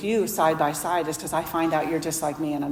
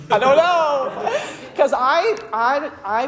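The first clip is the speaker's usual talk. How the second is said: laughing admission; 8 semitones higher, 4 dB louder, much slower than usual. Retold or imitated. Admitting ignorance, laughing.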